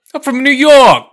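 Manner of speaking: put-on loud, high-pitched New York accent; hammed-up New York shout. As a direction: belt it out as an over-the-top New Yorker. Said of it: "a fucking Cuban waking up and being like".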